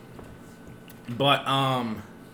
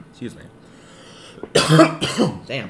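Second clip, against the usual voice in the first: ordinary delivery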